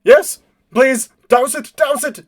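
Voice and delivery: loud, high, frantic pleading; panicked shouting. Acted. Captive with a lit bomb on him, panicked shouts.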